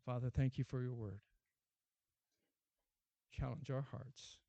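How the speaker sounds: closing prayer. Acted. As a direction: speak to God humbly and low.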